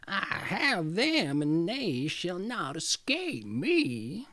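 slow, gloating witch voice; triumphant witch. Acted. Witch gloating over the coming children, slow and triumphant. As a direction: savour every word like a witch who has won. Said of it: "said triumphantly".

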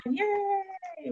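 high, soft, drawn-out yay; little cheer. Real, not acted. Teacher cheers that they're almost there, high soft yay. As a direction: stretch out a small, high-pitched cheer. Cheering the last lesson's start.

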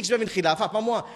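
ordinary talk, nothing marked

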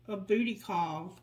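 usual speaking voice

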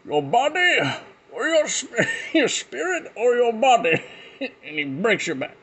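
a hammed-up Bane, cracking up. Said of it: "making fun of the voice".

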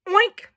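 short, high oink; quick squeaked-up oink, rising at the end; successful oink. Acted. Pig finally gets it, short high oink.

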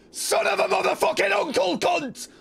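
high-pitched screamed curse; shrill-pitched yell of frustration; real angry outburst. Real, not acted. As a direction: scream it in exasperation at the game. Cursing outburst at the game.

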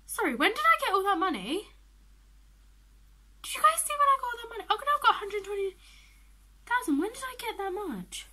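high, loud astonishment; loud, high-pitched shock; shocked disbelief. Real, not acted. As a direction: squeal it in disbelief at a windfall. Shocked at sudden game money.

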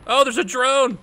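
high, loud sudden alarm; surprised shout. Real, not acted. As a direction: blurt it out in surprise. Spots a drone suddenly.

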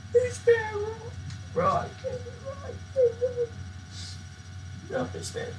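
high, whimpering sob, hammed up; mock crying. Gamer grieves for Fishman in the game, mock crying. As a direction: play heartbroken, voice thinning away. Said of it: "I'm so sad".

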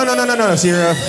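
ordinary talk

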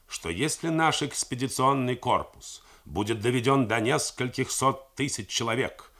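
ordinary delivery

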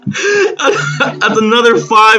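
real high-pitched jackpot excitement; ecstatic amazement. Real, not acted. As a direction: squeal it out, half laughing, stunned by the win. Amazed at winning five hundred again.

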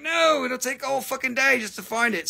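high exasperated complaint; mock exasperation. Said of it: "be like".

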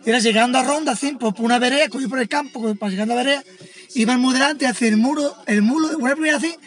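ordinary talk

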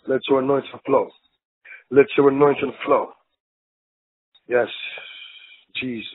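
a soft, intimate prayer; hushed prayer. Acted. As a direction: pray it softly under the breath. Hushed prayer over the people.

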